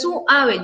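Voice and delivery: "suave" drawn out as su-ave; split diphthong. Shown as a demonstration. Teacher showing the wrong way, vowels kept apart. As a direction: slowly pull "suave" apart after the u. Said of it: "Yo no digo así".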